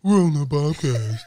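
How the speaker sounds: goofy SpongeBob-style delivery; just goofy, laughing. Shown as a demonstration. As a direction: say it like silly cartoon writing. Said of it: "What would that sound like?".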